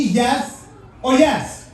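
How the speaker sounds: drawn out slow and teasing; playful slow push. Real, not acted. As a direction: stretch it out, daring them to say no. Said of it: "are you guys willing".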